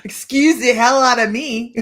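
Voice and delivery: slow, loud hammed-up huff; mock indignant. Laughing at a viewer comment, loud mock indignation. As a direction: stretch it out in playful outrage.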